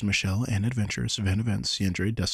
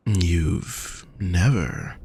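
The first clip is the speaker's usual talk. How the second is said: very slow, falling question; slow disbelief; much slower than usual. Acted. Hostess marveling the guest has never, very slow. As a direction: stretch out never in wonder.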